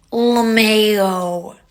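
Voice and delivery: loud, stretched mock-drunk "LaMayo"; sing-song "LaMayo" like a tipsy texter; mock-drunk voice reading his text; drawn-out mock drunk "LaMayo". Mocking his drunk texts aloud.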